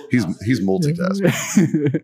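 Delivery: slow, high, drawn-out teasing; mock impressed. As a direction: stretch out multitasking mockingly. Teasing about absent host.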